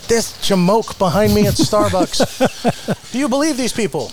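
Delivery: high, slow, put-on voice of an indignant customer; mock grumpy customer. Host mimics a ranting Starbucks customer, high and hammed-up. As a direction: ham up a fussy customer ranting about people. Mocking an angry coffee-shop customer.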